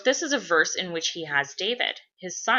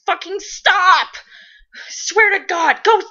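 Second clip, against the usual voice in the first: high-pitched frantic shouting, easing at the end; exasperated scolding; 12 semitones higher, 8 dB louder than usual. Acted. Balthazar smacking Cass's hand away, exasperated shouting. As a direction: yell like a fed-up older brother.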